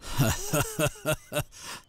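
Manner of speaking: sly amused villain laugh; scheming snicker; evil chuckle. Acted. Villain amused by murder question. (petit rire machiavélique)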